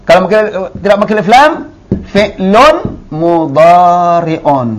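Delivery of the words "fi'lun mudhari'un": fi'lun mudhari'un very slow, ending un clear; teacher gives the right reading; correct reading. Shown as a demonstration. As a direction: stretch fi'lun mudhari'un, stress the un. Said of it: "Itu bacaannya".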